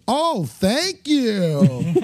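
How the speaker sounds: high, very drawn-out sarcastic thanks; fake nice. Players instead of booing the caller, sarcastically nice. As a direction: stretch it with syrupy false gratitude. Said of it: "act nice".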